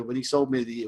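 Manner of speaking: ordinary talk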